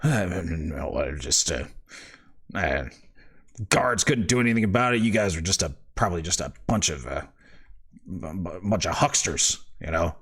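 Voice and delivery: low, sullen mutter; grumbling father. Acted.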